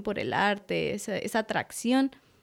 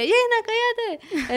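high, drawn-out mock holler; imitated maternal yell; 13 semitones higher, 4 dB louder, slower than usual. Mother hushing her singing daughter at home, imitated high yell. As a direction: holler to silence her, playfully exaggerated.

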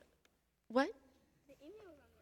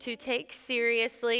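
leading a prayer; 2 semitones lower, slower than usual. Acted. Leads a repeat-after-me prayer.

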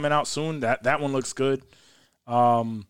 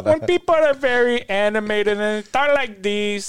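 Sofía Vergara; 5 semitones higher than usual. Shown as a demonstration. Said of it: "the Sofia Vergara".

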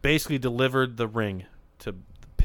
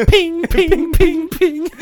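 very high, loud pinging; imitated metal pings; 10 dB louder, much slower than usual. Voicing bat hits for friends, high loud pings. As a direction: ring out like an aluminum bat.

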